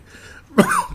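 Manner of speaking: breathless shrieking laughter; a laugh that cracks up high; high squealing laugh. Real, not acted. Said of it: "I'm choking to death here".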